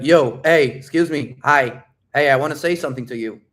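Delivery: bold, punchy attention grab; loud approach. Shown as a demonstration. Coach shows how to approach a girl, loud and confident. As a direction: call out so she has to hear you. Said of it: "like that".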